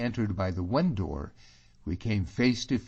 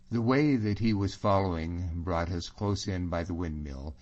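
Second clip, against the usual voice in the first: ordinary delivery, nothing marked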